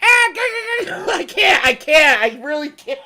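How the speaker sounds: high, loud words through laughter; real laughing fit; helpless laughter. Real, not acted. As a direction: crack up and fail to speak. Can't stop laughing.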